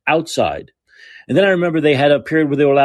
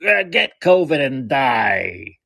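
slow, drawn-out heckler's holler; imitated yell; much slower than usual. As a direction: mimic a crank calling after someone, words stretched. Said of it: "So he yells at me".

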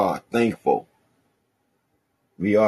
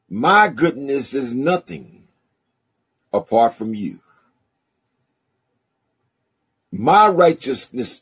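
slow, drawn-out sermon reading of the psalm; preaching the psalm; slower than usual. Acted. Pastor reading Psalm sixteen to his church, preaching. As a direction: preach each line with weight and pauses.

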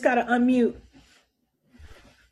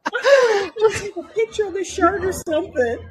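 laughing; 9 semitones higher than usual. Real, not acted. Laughing at teasing about shirt.

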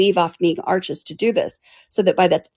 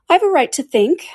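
high, indignant and quick; sharp retort; 10 semitones higher, 5 dB louder than usual. Acted. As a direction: snap back with indignation. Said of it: "Alice said sharply".

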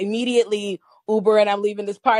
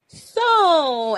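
imitating friend; 8 semitones higher, 4 dB louder than usual. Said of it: "and this is how she talks".

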